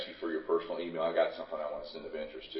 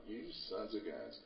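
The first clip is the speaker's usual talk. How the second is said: muttered under the breath, much softer; muttered thought; 3 semitones higher, 14 dB quieter than usual. Retold or imitated. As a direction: grumble it to yourself, half amused. Private thought about opposing groups.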